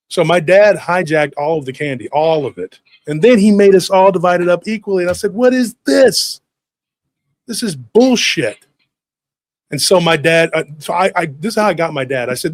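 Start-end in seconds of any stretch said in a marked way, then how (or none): 5.3-8.6 high, slow, loud indignation; outraged protest. Retold or imitated. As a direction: drag out each word in mock outrage. Kid protests equal division.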